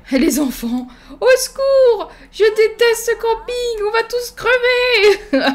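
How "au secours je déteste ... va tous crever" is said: very high, drawn-out mock wail; hammed-up panic. Player voicing terrified children at camp, hammed-up panic. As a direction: wail for help like a doomed camper. Mock screaming at camping disaster.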